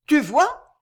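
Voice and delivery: high, loud cry with rising question; exclamation. A narrator's reading. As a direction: cry it out as proof, pitch leaping up. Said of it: "s'écria Violetta". (s'écrier, voix haute et forte)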